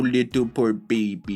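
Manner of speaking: normal talking voice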